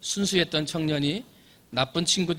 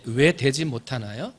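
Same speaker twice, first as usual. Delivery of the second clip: usual speaking voice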